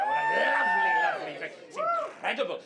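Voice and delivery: high, gushing mock delight; mock rapture. Comic praising Brighton to the crowd, high mock gush. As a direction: gush way up high like it's paradise.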